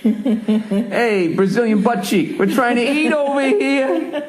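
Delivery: mock heckle. Heckling the strutting guy mockingly.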